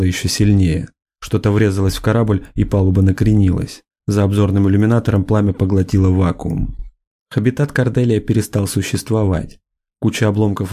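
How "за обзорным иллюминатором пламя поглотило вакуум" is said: usual speaking voice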